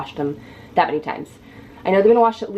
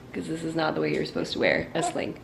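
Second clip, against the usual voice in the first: ordinary talk, nothing marked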